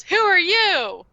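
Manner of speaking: high, loud, slow "who are you?"; strange dramatic question. Retold or imitated. Jason in a weird close-up, high, drawn-out dramatic question. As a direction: stretch it out like an odd movie moment.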